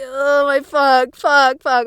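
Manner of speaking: drawn-out flustered cursing; slow, loud, rattled swearing; mock panic. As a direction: curse in hammed-up embarrassment. Acting flustered at the register.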